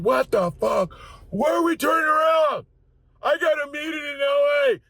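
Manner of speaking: high, loud angry shouting; angry passenger shout at the crew. Retold or imitated. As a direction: yell like furious passengers.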